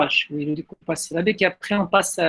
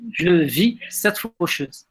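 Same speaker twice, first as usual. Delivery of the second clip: slow, with "vis" held before "cette faucheuse"; rhythmic verse declamation; slower than usual. Shown as a demonstration. Teacher modelling the chant for student, slow pause after "vis". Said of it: "Continuez là le chant".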